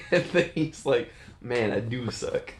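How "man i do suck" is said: dejected. Acted. Steve dejected after jeers.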